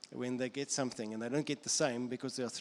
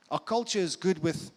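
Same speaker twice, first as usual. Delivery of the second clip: normal talking voice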